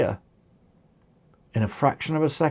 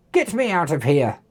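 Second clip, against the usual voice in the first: shouted. A narrator's reading. Oliver to his clones after being flattened, mild shout. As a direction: order them with restrained force. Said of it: "He pushed Beefy aside and shouted".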